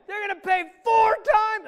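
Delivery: high, full-volume angry shouting; David, furious yell. Acted. Teacher as King David passing judgment on the thief, furious yell. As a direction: yell out the sentence in outrage. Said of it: "he was yelling".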